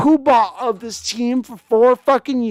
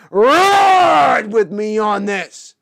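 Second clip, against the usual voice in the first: slow stretched rallying shout; drawn-out shout; 5 dB louder, much slower than usual. Real, not acted. Streamer rallying viewers with a long drawn-out shout.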